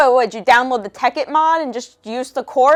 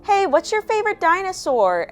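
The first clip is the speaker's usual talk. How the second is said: put-on chirpy kid voice; 9 semitones higher than usual. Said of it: "one of them's like".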